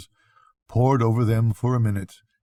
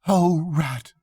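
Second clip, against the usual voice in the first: high, shaken cry of relief; a scared little animal finally safe; frightened cry; 7 semitones higher than usual. Acted. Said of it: "he cried".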